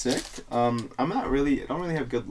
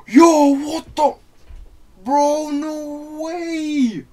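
stunned high yell, drawn out; shocked bro shouting at a hit; frat bro; 9 dB louder, much slower than usual. Real, not acted. Huge pull while opening packs.

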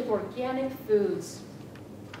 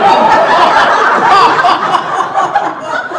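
a very loud, long evil cackle; maniacal cackle; 7 semitones higher, 20 dB louder than usual. Acted. Widow cackling to the audience after killing her husband. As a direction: let loose a triumphant wicked laugh.

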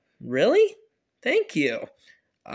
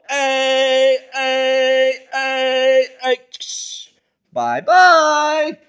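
shouting; 4 semitones higher, 7 dB louder, much slower than usual. Acted. Dad shouts as car leaves.

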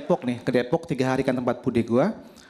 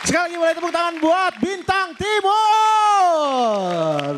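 high, slow, stretched announcer call; host announcement; 13 semitones higher, 4 dB louder, much slower than usual. Real, not acted. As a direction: drag out the name to rouse applause. (pembawa acara mengajak tepuk tangan dengan suara tinggi dan panjang)

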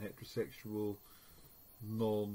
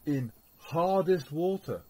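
slow, loud and raised, stressing each word; italic emphasis; 6 semitones higher, 9 dB louder, slower than usual. Shown as a demonstration. Teacher showing the class how the italics sound, slow loud emphasis. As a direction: lean on it like italic print. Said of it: "emphasis as well with things in italics".

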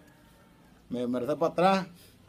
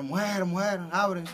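loud call to the wife, higher; retold call to the wife, raised and high; 6 semitones higher, 4 dB louder, slower than usual. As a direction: shout through the door for her to open. Calling wife to open door.

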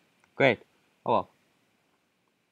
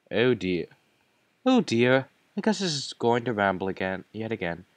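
usual speaking voice

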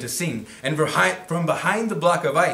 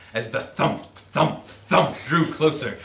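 usual speaking voice

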